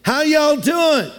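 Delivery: put-on Southern American accent, stretched out. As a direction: greet like a friendly Southerner, slowly. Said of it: "if you're in the South".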